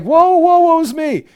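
high, loud lament; theatrical self-pitying cry; mock woeful Job. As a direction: wail like a hammed-up Job. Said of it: "And I'm Job".

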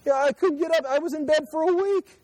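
high strained voice as the weak prophet; Daniel, bedridden and worn, voiced high; Daniel shaken. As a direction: sound wiped out by the angel's visit. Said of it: "And then Dan says".

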